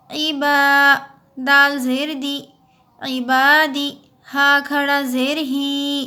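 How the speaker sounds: slow, measured spelling chant; teacher chanting syllables for pupils; slow recitation drill. Shown as a demonstration. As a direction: spell each word syllable by syllable.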